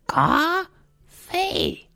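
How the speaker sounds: very high, slow falsetto, syllables split apart; Marge. Acted. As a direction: spell it out slowly as a cartoon mom. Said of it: "And she goes".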